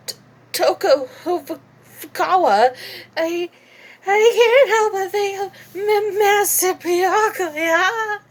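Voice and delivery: nervous stammering Toko, breaking up on every word; stuttering Toko voice. Acted. A fan stammering about her crush. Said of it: "very stutterful".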